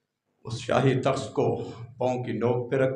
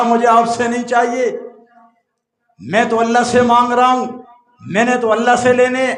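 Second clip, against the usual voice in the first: beggar's reply at full preaching force, higher and louder; 11 semitones higher, 16 dB louder than usual. Acted. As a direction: proclaim his refusal to the crowd. (فقیر کا جواب، بلند واعظانہ آواز میں)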